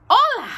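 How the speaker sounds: "Hola" said as ola with no H sound, bright and high; "Hola" with silent H, lifted and cheerful. A narrator's reading. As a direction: drop the H in "Hola", sing it out high. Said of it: "with a silent H".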